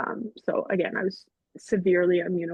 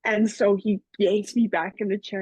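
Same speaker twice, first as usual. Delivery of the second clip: ordinary delivery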